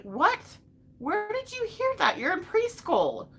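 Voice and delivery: high-pitched, mock-shocked questioning; acted parental disbelief, pitch leaping up; incredulous parent. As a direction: question a small child in disbelief.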